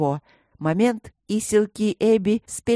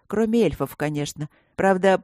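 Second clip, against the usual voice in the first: usual speaking voice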